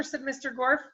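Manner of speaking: ordinary delivery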